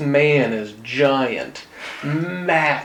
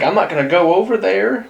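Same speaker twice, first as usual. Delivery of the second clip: ordinary talk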